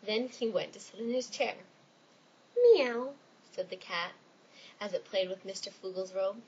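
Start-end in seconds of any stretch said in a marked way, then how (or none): 2.6-3.1 cat meow. Acted. Cat voiced in story.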